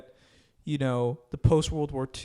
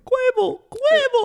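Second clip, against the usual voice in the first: high squeaky voice; 8 dB louder than usual. Retold or imitated. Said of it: "higher pitched".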